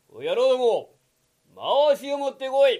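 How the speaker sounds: loud barked command to disciples; calling out orders. Acted. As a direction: shout for the loincloth. Yokozuna calls his disciples. (弟子を大声で呼びつける)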